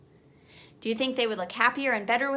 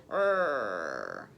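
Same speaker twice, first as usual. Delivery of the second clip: deep low growl like a dog; 3 dB quieter than usual. A narrator's reading. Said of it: "Willie, growled".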